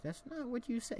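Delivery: put-on high, indignant child voice; indignant kid. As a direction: argue back like a wronged little kid. Kid argues with dealer.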